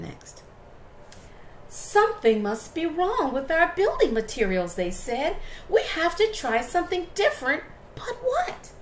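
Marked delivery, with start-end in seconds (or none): 1.7-8.7 high, worried little wolf; rising to a squeak at the end; little wolves voice. Acted.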